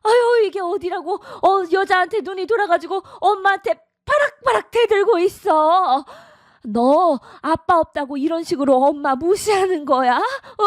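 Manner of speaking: high, heated scolding of a defiant son; indignant scolding. Acted. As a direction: pitch it up and scold him hard. Mother scolds son defying her.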